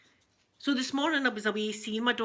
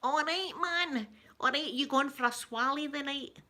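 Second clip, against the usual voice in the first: higher-pitched Scottish Ned voice; wee Ned impression; 5 semitones higher than usual. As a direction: put on a local Ned's greeting. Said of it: "the wee Neds talk round about here".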